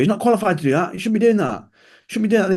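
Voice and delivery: high, indignant mock outrage; mocking trainers. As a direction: whine it like a huffy trainer. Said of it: "all personal trainers would go".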